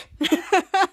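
short burst of laughter. Real, not acted. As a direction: break into a quick laugh. After customers' quoted remark.

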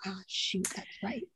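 mock parent whispering it, caught out; a parent realizing with a hushed oops; sheepish whisper. Daughter imitating a parent, caught out, in a sheepish whisper.

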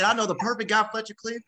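high-pitched, eager pitch of a name; table-top excitement, voice up high; retold excitement. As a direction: jump in with the perfect answer. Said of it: "I was just so excited".

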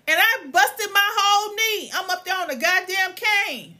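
high, loud indignant outburst; pissed-off complaint. Real, not acted. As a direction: protest the unfairness with pitch way up. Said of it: "Ooh, I was so pissed".

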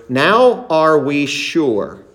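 slow, drawn-out "Now are we sure"; "Now are we sure" said slowly with weight; emphatic stress. Shown as a demonstration. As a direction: lean on "Now" and "sure", stretching each. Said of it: "Notice the emphasis on the word".

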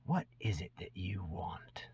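hushed, breathy question leaned in close; whisper. Acted. Goblin leans in to ask the stranger, whispering. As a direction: keep it secret, barely voiced.

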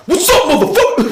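loud, high-pitched mock aggression; hammed-up confrontational yell; aggressive challenge. Stranger coming straight at two friends, imitated loud aggressive challenge. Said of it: "he comes at us very aggressively".

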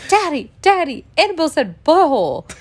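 put-on high, loud little-kid tattling; mock child's urgent report; imitated tattling daughter. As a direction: call out like a child telling Daddy. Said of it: "our middle daughter was like".

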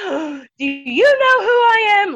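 high, loud, slow, indignant gasp-voice; hammed-up affronted authority figure; outraged RD. RD's outraged retort voiced.

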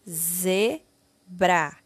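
ZEBRA split into two separate syllables, ze, bra. Shown as a demonstration. Teacher reading a word for pupils to copy, syllable by syllable. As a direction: sound out ZEBRA slowly with a gap between syllables. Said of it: "leia e copie".

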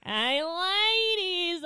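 very slow, high, loud goofy comedian; hammed-up zany comedian impression; Jerry Lewis. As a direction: stretch it into a squawking comic catchphrase. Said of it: "I'm good at Jerry Lewis".

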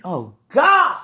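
high, loud cry of "God"; worked-up outburst. Real, not acted. Woman overcome thinking of the man she loves, worked-up outburst. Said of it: "I can't talk like that".

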